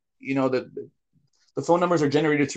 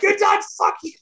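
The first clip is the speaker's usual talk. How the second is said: imitating scammers yelling. Scammers yelling back on the phone, hammed-up high shout. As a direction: yell it back furiously, hammed-up. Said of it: "these people are just yelling back".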